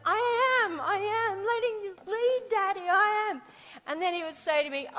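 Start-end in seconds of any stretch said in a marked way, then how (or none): 0.0-3.4 put-on high, louder childlike insistence; be the small daughter swearing she's following; little girl protesting. Little daughter insisting to her dancing dad, high and childlike.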